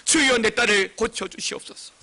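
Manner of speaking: loud, high cry easing toward the end; mother crying out for her daughter; pleading shout. Retold or imitated. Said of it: "소리를 지릅니다". (크게 부르짖으며 애원)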